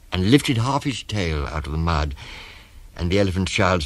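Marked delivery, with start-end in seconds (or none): none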